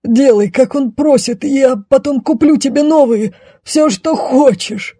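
high, pleading knight; desperate, whining coaxing. Acted. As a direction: beg in a high, moaning voice. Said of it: "отчаянно простонал рыцарь".

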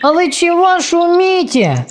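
high, drowsy small voice; sleepy child. A narrator's reading. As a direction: ask half-asleep. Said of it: "заспанный голосок". (заспанный голосок сына)

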